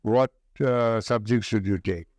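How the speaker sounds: ordinary delivery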